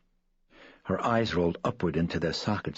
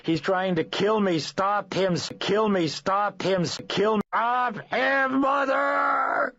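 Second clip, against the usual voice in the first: shrill, much higher panicked screams for help; terrified screaming; 5 dB louder, slower than usual. Acted. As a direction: scream in terror as if being murdered. Possessed girl screams for help.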